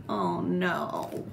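ordinary delivery

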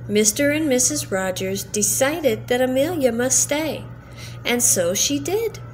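ordinary delivery, nothing marked

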